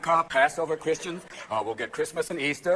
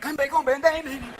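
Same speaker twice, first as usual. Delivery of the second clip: cheering fans; 9 semitones higher, 3 dB louder than usual. Retold or imitated. Brazilian fans chanting.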